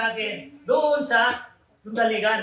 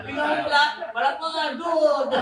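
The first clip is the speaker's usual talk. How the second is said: laughing; 7 semitones higher than usual. Real, not acted. Laughing through teasing.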